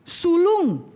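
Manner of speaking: God demanding Israel's release, slow and mighty. Acted. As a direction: command Pharaoh like God himself. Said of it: "Tuhan katakan".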